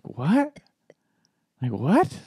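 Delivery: high-pitched rising "what?"; baffled. Retold or imitated. Storyteller recalls being blocked by a friend on Facebook, baffled.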